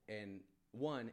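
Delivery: ordinary talk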